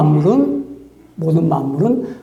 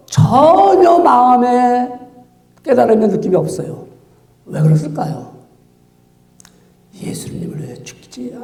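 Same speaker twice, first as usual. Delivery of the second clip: ordinary talk, nothing marked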